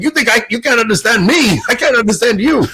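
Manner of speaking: high, loud mock Scottish brogue, shouted back; Scottish priest. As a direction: bellow it like an indignant Scotsman. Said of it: "a Scottish priest up there that I could barely understand".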